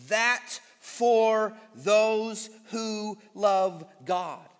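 drawn out slow, leaning on for; slow weighted reading. Acted. Preacher stressing the verse's opening, very slow. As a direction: savour each word of the phrase, slowly.

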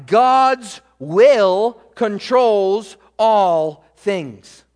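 "God's will controls all" drawn out very slowly and loud; preacher hammering home God's control, slow and loud; very slow emphatic. Acted. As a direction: stretch every word, preacher's emphasis. Preaching climax, stretched.

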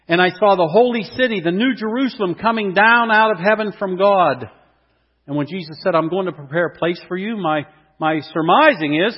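loud, high, soaring sermon reading; rising preacher's proclamation; preaching at full force. Acted. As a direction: lift the scripture up to the back row.

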